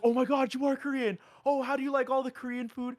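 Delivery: high, loud mock squeal of excitement; gushing fan imitation. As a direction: imitate an over-thrilled fangirl, really loud.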